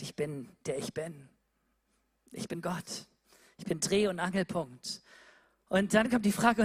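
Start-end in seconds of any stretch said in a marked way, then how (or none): 0.0-5.0 low, soft, solemn divine voice; voice of God. Acted. God answering Moses, low and hushed. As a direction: speak as God, lower and hushed.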